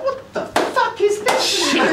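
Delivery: high baffled outburst; retold disbelief. Comic in the gallery reacting to the applause, high and baffled. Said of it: "I was there going".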